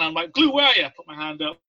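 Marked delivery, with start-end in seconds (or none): 0.3-0.8 raised, louder searching call. Retold or imitated. Petty officer looking for the recruit, calling out. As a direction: call across a room for someone.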